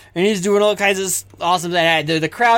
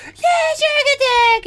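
very high, strained crowd yell; cheering crowd; 3 dB louder, much slower than usual. Acted. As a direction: stretch out a shrieked fan chant. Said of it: "they're like".

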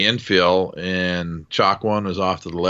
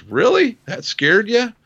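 much higher, teasing disbelief; mock surprise; 4 dB louder than usual. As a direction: play it like he can't believe you. Pilot teasing the scared gunner.

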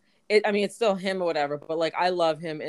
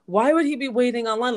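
louder, higher disbelief; higher, louder retold disbelief; incredulous; 6 semitones higher, 5 dB louder than usual. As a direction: ask it like you can't believe it. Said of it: "I'm just like".

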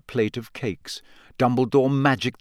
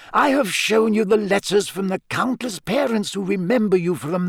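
put-on high-pitched, emphatic old headmaster; firm headmaster; 8 semitones higher than usual. Headmaster reassuring his gamekeeper, firm and insistent. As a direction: press the point as headmaster. Narrator voices Dumbledore.

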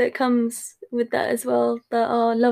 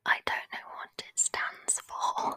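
hushed breathy whisper; 9 dB quieter than usual. Pretend. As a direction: whisper while guessing. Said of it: "start to whisper".

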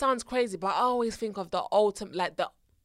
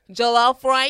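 "Jollof rice" again, more American and laughing; American accent; 6 dB louder, slower than usual. Shown as a demonstration.

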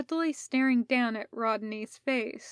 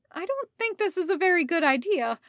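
fast, high nervous babble; babbled in a pitched-up rush; babbling; 7 semitones higher, 4 dB louder, faster than usual. A narrator's reading. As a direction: rush the words out, flustered. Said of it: "Rodney babbled".

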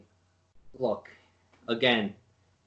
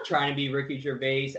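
ordinary talk

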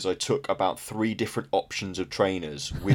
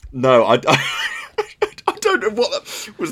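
high, loud, helpless laughter through the words; laughing; 13 semitones higher, 7 dB louder than usual. Real, not acted. Co-host cracking up at the host's odd accent, laughing. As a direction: barely get it out for laughing.

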